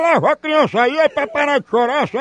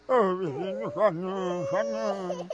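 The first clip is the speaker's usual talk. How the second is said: much softer, pretend lullaby hush to a baby; mock hushing a baby; 8 semitones lower, 13 dB quieter than usual. Prank caller pretends to calm a crying baby, much softer. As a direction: coo the words softly as if the crying baby were right beside you.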